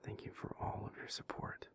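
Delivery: soft grateful whisper, close to the ear; tender whisper. Acted. Speaker whispers thanks to the listener for support. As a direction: thank them gently, barely voiced.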